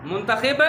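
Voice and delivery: मुंतखिब ending in "-khib"; short i after the kh in मुंतखिब; mispronunciation demo. Shown as a demonstration. Teacher showing pupils the error, mispronunciation demo. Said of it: "गलत".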